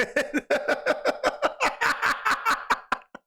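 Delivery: long high-pitched laughing fit; helpless laughter, high and drawn out. Real, not acted. Cracks up at the joke.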